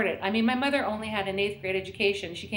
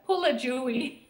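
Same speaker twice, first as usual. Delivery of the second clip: put-on Spanish accent; 3 semitones higher than usual. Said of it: "Spanish accent is so heavy".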